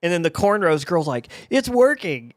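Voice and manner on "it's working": high, loud mock onlooker; pitched-up, raised-voice impression of a drunk girl; drunk girl calling out. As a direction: imitate an excited bystander announcing success. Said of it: "the drunk girl in the background".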